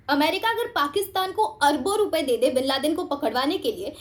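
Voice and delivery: usual speaking voice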